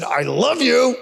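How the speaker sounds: high, sour mock affection; sarcastic. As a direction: say it as if you mean the opposite. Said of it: "that wasn't like".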